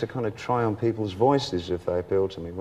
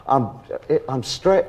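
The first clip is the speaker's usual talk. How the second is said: usual speaking voice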